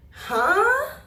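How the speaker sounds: high, loud, disbelieving huh; floored by a stranger's proposal; shocked huh. Retold or imitated. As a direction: react as if you misheard something outrageous. Shocked by the stepmother remark.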